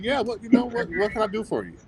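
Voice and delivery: bright, high and quick, unaware of the news; imitating his own chipper hello; cheery phone greeting. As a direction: answer the call all sunny and eager.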